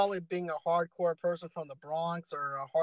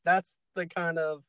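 ordinary delivery

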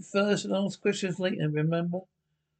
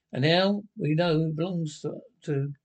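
ordinary talk, nothing marked

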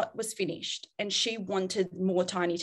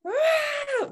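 put-on fiery child. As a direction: throw out a child's furious "Ah". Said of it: "They will come hard at me and be like".

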